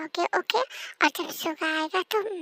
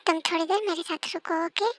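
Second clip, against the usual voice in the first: ordinary delivery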